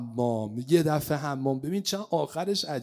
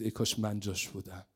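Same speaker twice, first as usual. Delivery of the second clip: wistful murmur; 7 semitones lower, 6 dB quieter than usual. Acted. Uncle's wistful words.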